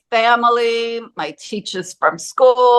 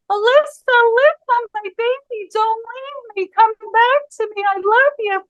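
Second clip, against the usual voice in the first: high-pitched retold wail, not at full volume; screaming, crying mother; 13 semitones higher than usual. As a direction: imitate a distraught mother pleading with her child. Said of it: "she kept screaming, crying".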